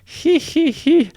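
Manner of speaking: very high nasal-free titter, sneering; high mocking giggle "he he he". A narrator's reading. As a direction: cackle up high at the brats. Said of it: "Nero said, shaking his pigtails in laughter".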